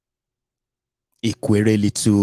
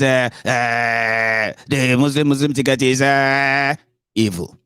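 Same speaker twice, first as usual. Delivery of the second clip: higher voice with long dragged-out hesitations; Were Wike; 3 semitones higher, slower than usual. Retold or imitated. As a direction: stall on a stretched "uh" before the last word. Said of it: "I actually wanted to talk like that when I talk about, uh, Were Wike".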